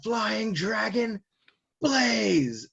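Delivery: high, drawn-out battle cry; yelled attack name. Retold or imitated. Recapper as swordsman launching his attack, yelling. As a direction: stretch each word like an anime finishing move. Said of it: "Zoro yells out".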